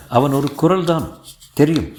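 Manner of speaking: plain reading voice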